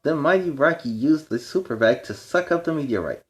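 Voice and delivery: usual speaking voice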